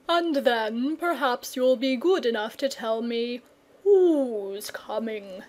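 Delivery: slow, lazy dragon voice, calmly correcting; a languid old dragon taking his time; unhurried dragon. Acted.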